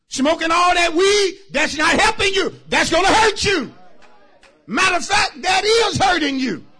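high, loud preacher's shout; loud, urgent pulpit exhortation; full-force preaching. Real, not acted. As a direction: drive the warning home at full voice.

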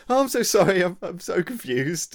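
high, loud, gushing apology; flustered apology. Retold or imitated. Apologising to the wrongly approached person, flustered and high. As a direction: act out a mortified apology, pitched right up.

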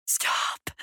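soft, husky whisper. A narrator's reading. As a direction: whisper it huskily to the voices. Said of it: "she said huskily".

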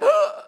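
a high sharp grunt as if punched in the chest; punched in the chest, a gasping ugh; winded grunt. Pretend. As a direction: get the wind knocked out of you. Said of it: "And you'll be like".

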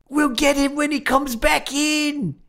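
high, slow, drawn-out mock Australian shout; bad Australian accent. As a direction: imitate a hammed-up Australian cop calling out, high and slow. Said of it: "doing a bad Australian accent".

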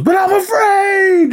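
very high, drawn-out mock wail; hammed-up falsetto cry of fear; mock cowardly wail. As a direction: wail it slowly like a big baby. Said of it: "he's just pussying out".

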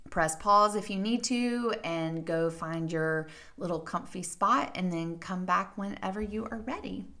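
usual speaking voice

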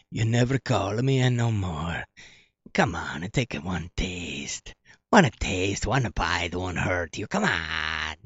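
low, slow coaxing temptation; lightly voiced seductive pizza; pleading pizza voice. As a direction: coax lightly like a needy tempting ex.

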